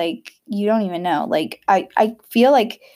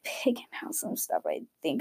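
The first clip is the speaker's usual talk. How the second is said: whisper; 4 semitones lower, 13 dB quieter than usual. Real, not acted. Whispered aside about shopping plans.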